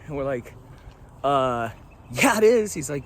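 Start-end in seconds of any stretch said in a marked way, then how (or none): 1.2-2.7 rising, louder push on yeah it is; mock indignant insist. Retelling to camera, insisting it's the hiker box, loud. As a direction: insist they're right, half laughing. Retelling their confident reply.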